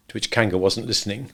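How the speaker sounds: usual speaking voice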